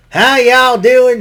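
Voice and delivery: slow, high drawling cowboy greeting. Retold or imitated. Podcaster mimicking Lesnar's entrance, slow high cowboy drawl. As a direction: stretch it out like a country boy. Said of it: "It sound like a ca-, redneck cowboy".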